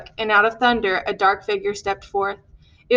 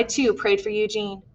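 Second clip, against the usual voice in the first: ordinary talk, nothing marked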